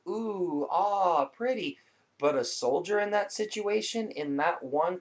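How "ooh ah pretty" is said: high, breathy ooh and ah; impressed oohing. Retold or imitated. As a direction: coo like a wowed crowd. Mimics fireworks crowd's reaction.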